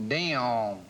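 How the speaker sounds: DAMN stretched into two sounds; mock Southern pronunciation; imitated Southern drawl. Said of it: "They put an L in there".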